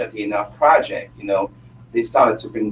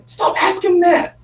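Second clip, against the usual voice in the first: imitating the coach; 3 dB louder, slower than usual. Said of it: "Coach is like".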